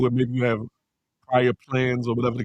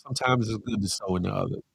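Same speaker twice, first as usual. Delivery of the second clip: softer, lower sincere restatement; quieter, lower repeat; 6 semitones lower, 6 dB quieter, faster than usual. Shown as a demonstration. Urging viewers to give, softly and sincerely. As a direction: let it sink in, gently.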